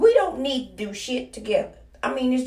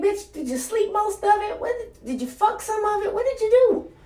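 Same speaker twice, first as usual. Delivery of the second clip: much higher, incredulous questions to herself; exasperated self-scolding; 11 semitones higher than usual. Acted. Vlogger scolding herself for wasting her day, disbelieving and high. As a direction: grill yourself like you can't believe it. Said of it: "It's like".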